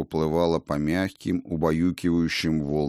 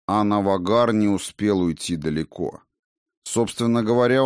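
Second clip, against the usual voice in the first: ordinary delivery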